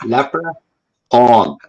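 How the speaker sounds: very slow, laughing, drawn-out leprechaun; stretched mocking leprechaun. Shown as a demonstration. Mocking the leprechaun myth to his guest, slow laughing drawl. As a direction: drag out every sound of leprechaun through a laugh, as a joke. Repeats word to mock the legend.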